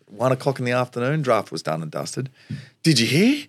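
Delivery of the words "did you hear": high, eager voice of a mate; excited news-breaking. Retold or imitated. Mate breaking draft news in the common room, excited. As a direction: burst in with big news, pitched high.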